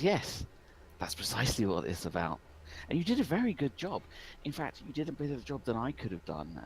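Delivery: lightly acted smug villain with high swoops; a touch of gloating condescension; Listy. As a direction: leap up on the praise, mock-gracious. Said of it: "Listy looks over you, son, and says".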